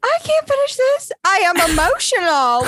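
high, overwrought wail of dismay; mock distress. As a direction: ham up being overwhelmed. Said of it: "Like, I was sad".

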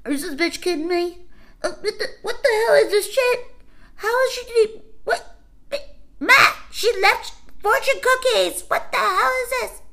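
high, loud mocking kid voices; kids cursing. Retelling kids at the door cursing her out in high loud voices. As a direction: squeal it like outraged bratty kids. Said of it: "I would hear the kids outside cursing".

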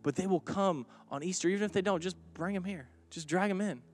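rally the congregation with rising fervor; fervent preaching. Acted. Preaching at full force.

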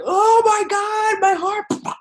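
high, slow, breathless mock panic; hammed-up high panic over a racing heart; mock overwhelm. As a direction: gasp it out like your heart is bursting. Mock overwhelmed feeling, acted.

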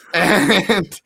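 ordinary delivery

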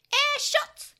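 very high, loud battle cry; attack shout. Acted. Patamon attacking Pico Devimon, high and loud. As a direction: fire the attack with a yell.

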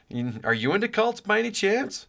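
high-pitched, awkwardly hopeful question; mock conversation opener. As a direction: playfully sound out a coworker, pitched well up. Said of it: "how you should open that conversation".

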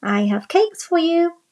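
put-on little girl. A narrator's reading. Girl at door.

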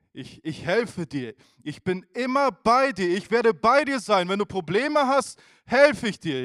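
God urging reluctant Moses on, loud and high; loud encouragement. Retold or imitated. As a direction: call out I'm with you, emphatically.

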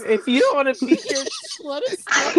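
high, loud indignant snap; mock offended outburst. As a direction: pretend to be hurt and huffy. Mock outburst at Alex again.

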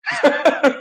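a quick high burst of laughter; short laugh. Real, not acted. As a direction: laugh at the lucky break. Ri após a frase do Remy. (risada curta e aguda)

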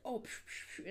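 breathy imitated zap sounds; whispered psh noises. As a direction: puff out soft psh noises.

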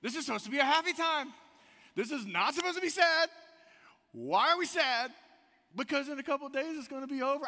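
loud, high, incredulous protest to his wife; mock outrage at a sad vacation; exasperated husband. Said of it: "And I'm like".

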